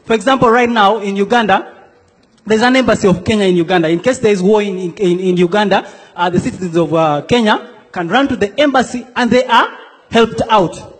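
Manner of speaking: normal talking voice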